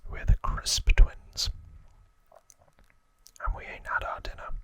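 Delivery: whispered Cockney gangster voice; Cockney accent. Acted. Albert Crisp warning a newcomer to London, whispered Cockney accent. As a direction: put on your best Cockney, all in a whisper. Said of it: "That's my best Cockney accent".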